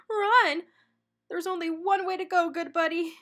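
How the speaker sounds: loud, high, fast yell. A narrator's reading. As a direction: shout over the wind. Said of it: "Shaggy yelled above the wind".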